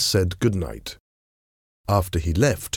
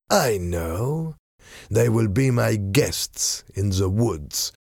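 slow, deliberate villain voice; scheming masked villain. Acted. Masked man tells his men of the useful letter, slow and scheming. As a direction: savour each word of the scheme. Villain decides captives' fate.

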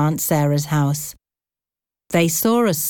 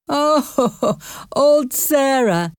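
slow, high chuckling laugh; 8 semitones higher, much slower than usual. Acted. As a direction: laugh warmly through the name. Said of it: "he laughed".

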